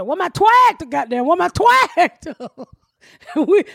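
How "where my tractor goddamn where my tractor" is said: higher mock yell of the lost tractor; imitating the man, pitched up. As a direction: call out high for the tractor, hammed up. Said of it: "The man said".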